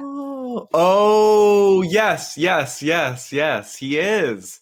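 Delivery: light-bulb moment, stretch it out; sudden realization. Real, not acted. Realizing the laugh is Billy's.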